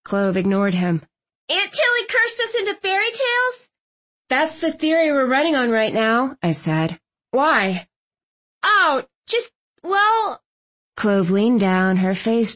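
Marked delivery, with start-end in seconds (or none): 1.5-10.4 high, voiced young heroine; Clove's voice. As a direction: lift the voice high for her.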